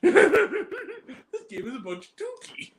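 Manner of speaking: very high, slow, laughing quote; cracking up. Retold or imitated. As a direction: squeak the joke out through laughter. Retells joke while cracking up.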